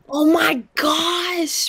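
shocked gasp. Real, not acted. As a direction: stretch it out in loud disbelief. Shocked at someone still online.

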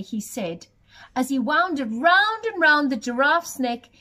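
ordinary delivery, nothing marked

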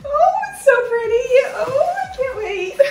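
gushing, delighted squeal; high excited squeal. Real, not acted.

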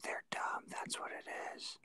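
soft unvoiced whisper. Real, not acted. Friend whispers a snarky verdict on the characters, hushed. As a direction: breathe it out under your breath. Whispered joke during reading.

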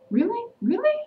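high, sharply rising disbelief; incredulous. Retold or imitated. Vlogger reacting to a character's insult, incredulous. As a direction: question it in high-pitched disbelief.

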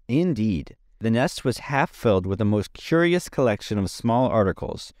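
ordinary delivery, nothing marked

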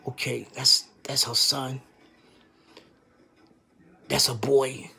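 low hushed murmur to himself; hushed inner thought. Real, not acted. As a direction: talk it under your breath like a private thought.

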